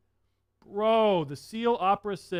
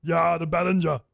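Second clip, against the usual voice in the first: mock German accent; 3 dB louder than usual. Shown as a demonstration. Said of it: "That's a terrible accent".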